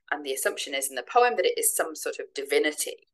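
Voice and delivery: ordinary talk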